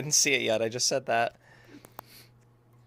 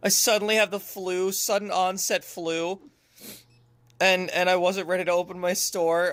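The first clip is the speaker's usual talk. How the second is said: usual speaking voice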